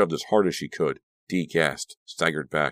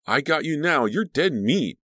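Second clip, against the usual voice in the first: menacing taunt; 10 semitones higher than usual. Acted.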